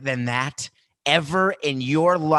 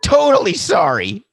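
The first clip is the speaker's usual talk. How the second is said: much higher, louder mocking sorry; hammed-up sarcasm; mock apology; 12 semitones higher, 9 dB louder than usual. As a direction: squeal a fake apology.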